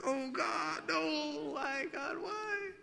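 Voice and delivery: high, strained mock despair; hammed-up embarrassed plea; comically mortified dad; mortified groan. Mortified parent reacting.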